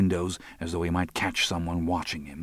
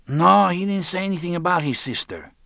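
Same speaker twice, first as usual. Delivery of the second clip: high-pitched, louder character voice; Lionel's phone voice; 12 semitones higher, 6 dB louder than usual. A narrator's reading. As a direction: answer in a high, insistent character voice.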